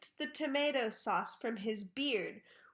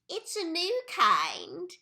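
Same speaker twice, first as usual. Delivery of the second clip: Missus Twit; 7 semitones higher, 6 dB louder than usual. A narrator's reading. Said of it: "Missus Twit said".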